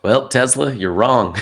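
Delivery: loud, high mock rebuke of Tesla; mock scolding. Joking to his friend about Tesla, mock scolding. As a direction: playfully scold the great inventor. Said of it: "I'm kidding".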